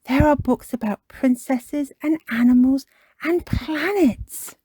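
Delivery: put-on high, loud, excited child; Peppa Pig. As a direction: list them with mounting delight. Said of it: "says Peppa".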